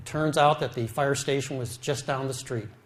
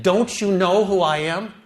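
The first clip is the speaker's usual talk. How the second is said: higher, louder, slow and frantic; panicked husband yelling at the nurse; frantic scream; 5 semitones higher, 6 dB louder than usual. Retold or imitated. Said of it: "I screamed at her".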